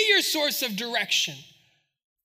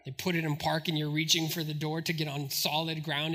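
usual speaking voice

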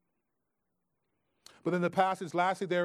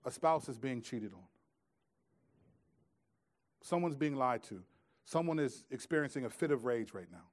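ordinary talk

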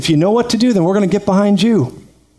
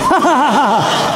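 demonstration laugh; 5 semitones higher than usual. Shown as a demonstration. Pastor leading congregation in a deliberate laugh, hearty. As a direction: lead the room in a big laugh. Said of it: "One more time".

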